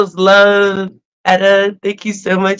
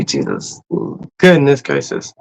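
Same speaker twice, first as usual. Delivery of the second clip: whispered praise; 6 semitones lower, 5 dB quieter than usual. Real, not acted.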